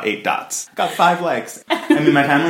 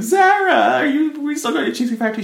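put-on panicked teen call. Calling friend, acting panic.

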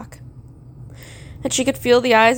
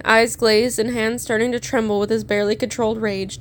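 ordinary delivery, nothing marked

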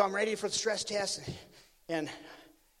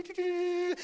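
high, even electronic beeping; sustained high-pitched mimicked beeps; imitated machine beeps; 10 semitones higher than usual. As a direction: beep like a treadmill keypad. Imitates new electronic treadmill's beeps.

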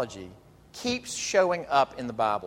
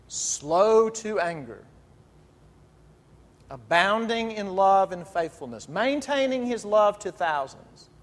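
slow solemn recital; 5 semitones higher, slower than usual. Acted. Reciting God's self-description.

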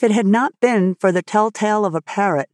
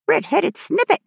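high squawk, rising at the end; contemptuous parrot; 11 semitones higher than usual. A narrator's reading. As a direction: squawk the insult like a scornful parrot. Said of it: "in a tone of profound contempt".

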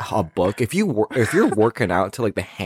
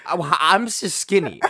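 normal talking voice

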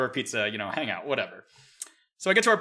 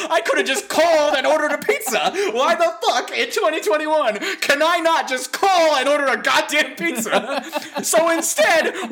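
higher, laughing exasperated rant; 10 semitones higher, 4 dB louder than usual. Real, not acted. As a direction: rant through laughter at how absurd it is. Laughing rant about pizza ordering.

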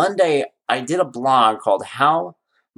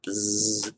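buzzer noise; 8 dB quieter than usual. Retold or imitated. Dad switching on clippers at the sink, buzzing noise. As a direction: buzz like hair clippers. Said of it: "turned it on".

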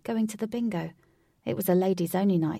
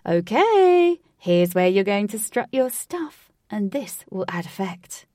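lightly voiced theatrical host; flamboyant host; 9 dB louder than usual. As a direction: sell the stage with flair.

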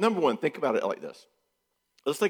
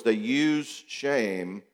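normal talking voice

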